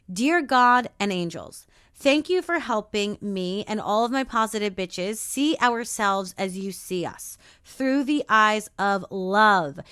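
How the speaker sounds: slow, measured prayer reading. Acted. Reads a prayer over listeners.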